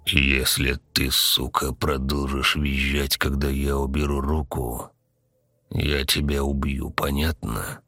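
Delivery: soft, smiling death threat; perfectly calm threat. A narrator's reading. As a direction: threaten calmly, keeping it low and unhurried. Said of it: "Совершенно спокойно, даже с улыбочкой".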